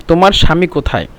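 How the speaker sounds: ordinary delivery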